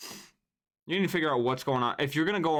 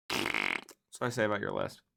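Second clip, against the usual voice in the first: mock dismissal. Mock-deep dismissal of the list.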